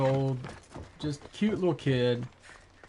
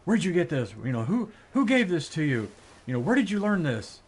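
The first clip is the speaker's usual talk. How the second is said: raised, higher mock-angry dad interrogating; imitating a father demanding answers from his son, loud and higher; 5 semitones higher, 5 dB louder than usual. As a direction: bark the questions like a fed-up father. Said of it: "he's all angry at his son".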